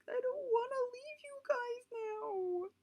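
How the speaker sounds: high, slow, crying plea; high, slow, choked-up farewell; tearful goodbye. Retold or imitated. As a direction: hold on to them and cry it out.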